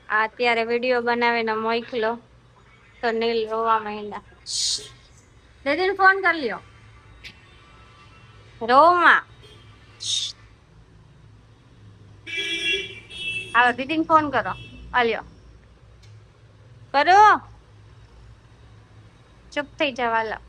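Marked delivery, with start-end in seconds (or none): 5.6-17.4 loud urging. Real, not acted. Urging Neel to call sister.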